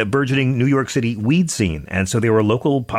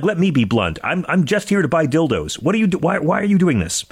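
exasperated; 7 semitones higher than usual. Retold or imitated. Quotes himself, exasperated.

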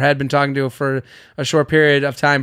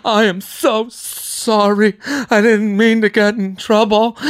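higher, slow imitation of a crying woman; mock crying apology; 5 semitones higher, slower than usual. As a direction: plead high and slow, lightly sobbing. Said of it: "She's crying. She's going".

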